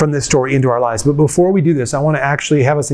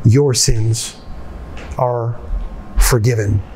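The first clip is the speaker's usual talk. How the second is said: tender; 5 semitones lower, slower than usual. Acted. Jesus speaks directly to the woman.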